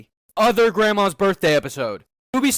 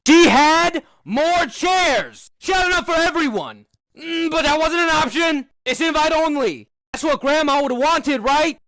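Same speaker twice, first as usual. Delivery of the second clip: high, heated near-shouting; exasperated rant; 6 semitones higher than usual. Real, not acted. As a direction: hammer each point in outrage. Ranting that she had chairs.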